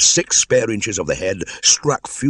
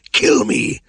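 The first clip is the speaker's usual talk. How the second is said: loud unvoiced hiss; 7 dB louder than usual. Defeated cobra begging to die, hissed. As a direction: spit it out like a beaten snake.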